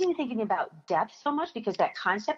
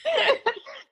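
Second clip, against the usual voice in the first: short, high amused laugh. Real, not acted. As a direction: chuckle at the memory. Laughing while telling the story.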